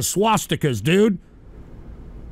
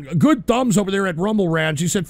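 ordinary delivery

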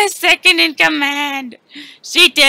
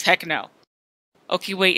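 usual speaking voice

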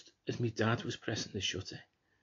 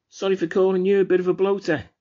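raised, high, loud jibe; loud taunt; 8 semitones higher, 10 dB louder than usual. Acted. Brother mocks sister's message from the dead, loud taunt. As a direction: throw the insult at her loudly.

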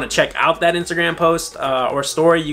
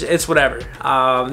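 ordinary delivery

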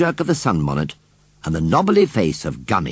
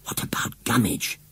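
softer secret whisper; 4 semitones higher, 5 dB quieter than usual. Acted. John whispering to Susan, secretive whisper. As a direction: whisper it so the washerwoman won't hear. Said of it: "whispered John to Susan".

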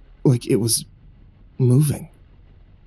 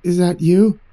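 off-kilter tone; 3 semitones higher, slower than usual. Acted. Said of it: "the same off-kilter tone, but wasn't quite so high-pitched".

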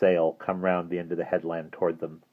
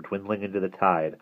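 usual speaking voice

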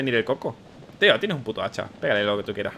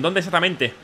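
normal talking voice

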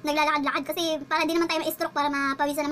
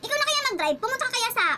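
retold sassy retort; 6 semitones higher than usual. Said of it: "Kaya sinagot ko siya".

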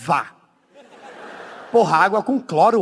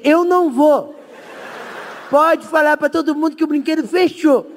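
high, panicky no, voice pitched up; scared refusal; 7 semitones higher, 3 dB louder than usual. Retold or imitated. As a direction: refuse in a frightened squeak. Tigas travado recusando descer. (recusa aguda e apavorada)

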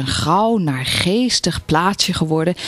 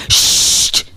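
breathy interrupting "sst"; sharp shush; 4 dB louder than usual. A narrator's reading. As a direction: hush the neighbour before the word is out. Neighbour hushes the other once more. (waarschuwend sissen)

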